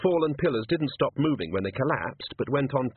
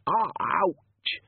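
pained yelp; 7 semitones higher than usual. A narrator's reading.

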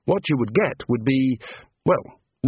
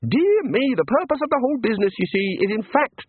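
high, bright small-god voice; cheerful little god; 11 semitones higher than usual. A narrator's reading. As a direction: play an eager little craftsman god. God character voice.

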